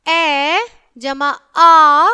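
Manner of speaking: loud, very slow "ए जमा आ" said for the class to repeat; loud slow recital of ए and आ; sounding out vowels. Shown as a demonstration. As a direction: stretch each sound so children echo it. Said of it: "स्वर को अच्छे से मेरे साथ पढ़ेंगे".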